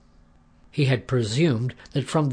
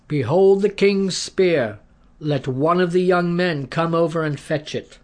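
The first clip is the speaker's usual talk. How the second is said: calling back; 5 semitones higher, 5 dB louder than usual. A narrator's reading. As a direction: call out steadily so he hears. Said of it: "called back".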